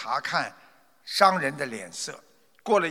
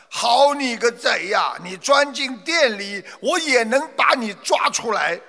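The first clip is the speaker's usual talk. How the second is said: higher, louder angry roar; 6 semitones higher, 4 dB louder than usual. Acted. Merchant roars at the thief outside the shop. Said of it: "商人赶紧上来吼的".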